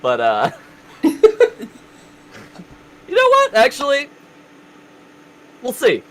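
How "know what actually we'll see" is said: excited. Real, not acted. A sudden high, loud burst.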